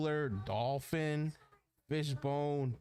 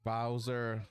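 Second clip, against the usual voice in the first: usual speaking voice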